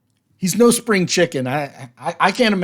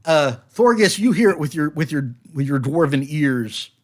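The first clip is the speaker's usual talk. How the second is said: usual speaking voice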